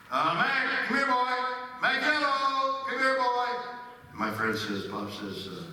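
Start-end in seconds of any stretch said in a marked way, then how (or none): 0.1-3.5 high, sing-song call to a dog, stretching Macchiato. Retold or imitated. Owner calling his dog across the backyard, high and coaxing. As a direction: coax the dog back in a lilting high voice.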